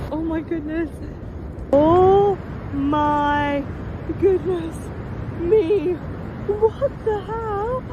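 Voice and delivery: high, drawn-out real astonishment; awestruck amazement. Real, not acted. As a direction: gasp it out, stunned by the sight. Amazed by frozen falls.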